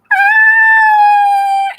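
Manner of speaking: long, loud high-pitched screech; imitated car screech. Recounting a car coming at her, loud high screech. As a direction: belt out a screaming car noise. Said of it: "I hear".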